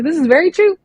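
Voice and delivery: replaying her own thrilled reaction; excited agreement. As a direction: burst out agreeing. Host quoting her reaction to bio.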